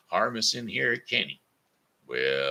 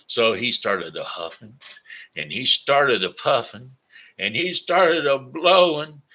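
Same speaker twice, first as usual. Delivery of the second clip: each phrase higher and louder; building narration; 6 semitones higher, 8 dB louder than usual. A narrator's reading. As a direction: build the tension of the huffing.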